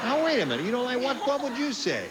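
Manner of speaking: high, loud, pressing for answers; worked up and eager; excited. Real, not acted. Host excited at a panel answer.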